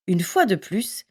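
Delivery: plain reading voice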